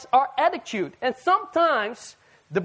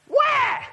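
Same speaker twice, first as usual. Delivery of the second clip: high-pitched mock baby wail; shrieked "wah" like a demanding baby; imitated baby cry; 4 dB louder than usual. Preacher voicing a baby wanting attention, high mock wail. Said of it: "the baby goes".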